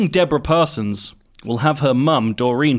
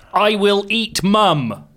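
dramatic headline read; 8 semitones higher, much slower than usual. Acted. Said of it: "headline read out".

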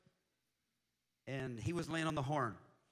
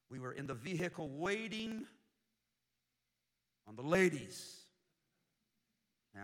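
usual speaking voice

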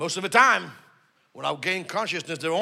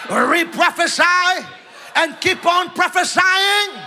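high, driving preacher's shout; rousing revival-style exhortation; full-force preaching; 12 semitones higher, 7 dB louder than usual. Real, not acted. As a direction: preach it up to a long, high cry.

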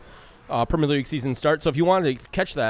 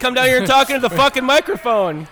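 loud, high call across the bar, laughing at the end; shouting out; 11 semitones higher, 8 dB louder than usual. Real, not acted. Podcast host shouting at bar hecklers to come to the mic, loud and high.

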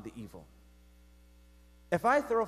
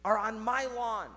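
mock yelling neighbour; 7 semitones higher than usual. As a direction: complain in a raised, stretched mock yell. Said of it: "he starts yelling and he's like".

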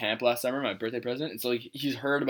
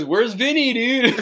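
put-on high, raised drunken call; drunk friend; 9 semitones higher, 5 dB louder, slower than usual. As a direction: imitate a drunk calling out for his buddy. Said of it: "And then he goes".

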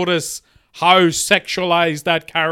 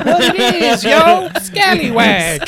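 high, slow hype-man greeting; goofy mascot greeting; 8 semitones higher, slower than usual. Pretend. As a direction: sell it like a cheesy cartoon host. Scallywag character greeting.

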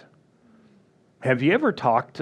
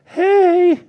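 much higher, louder sing-song hey; mock cheerful greeting; 6 dB louder than usual. Host greeting arriving guests, put-on bright and high.

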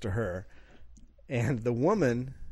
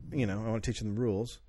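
ordinary talk, nothing marked

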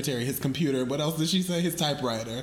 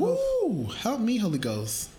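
churchy excitement; 8 semitones higher, slower than usual. Pretend. Churchy exclamation at the number.

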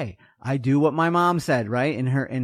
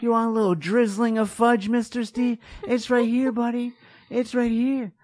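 higher, coaxing sales pitch to Mister Steve; luring vendor voice; 6 semitones higher than usual. Acted. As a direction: tempt him like a sweet-talking vendor. Tempting Steve at waffle stand.

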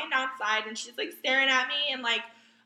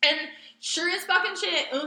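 usual speaking voice

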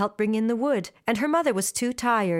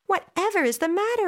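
surprised mother; 9 semitones higher than usual. A narrator's reading. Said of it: "asked her mother in surprise".

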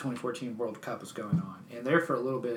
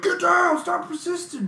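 high loud cop command; cop shout; 9 dB louder, slower than usual. Retold or imitated. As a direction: bark it like a cop. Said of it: "been like".

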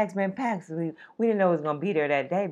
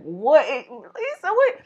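excited greeting; 9 semitones higher, 5 dB louder, much slower than usual. Pretend. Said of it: "Everybody start hugging. Like".

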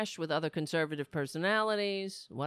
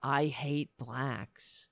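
menacing mock quote; 7 semitones lower, much slower than usual. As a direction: drag it out low and ugly. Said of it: "What do they know about honor?".

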